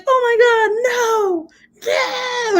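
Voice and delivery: high, drawn-out mock wail; screamed like a woman. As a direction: imitate a child's horrified shrieking, stretched out. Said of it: "he fucking screamed like a woman".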